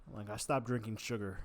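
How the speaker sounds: ordinary talk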